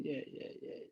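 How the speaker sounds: whispered, meek agreement; a whispered mock surrender. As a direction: give in under your breath. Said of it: "I say".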